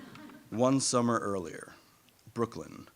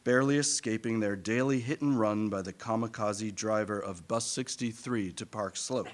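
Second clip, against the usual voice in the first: ordinary talk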